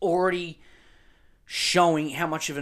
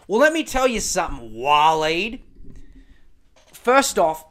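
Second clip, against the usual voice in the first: rant opener; 6 dB louder than usual. Real, not acted. Host squares up to rebut.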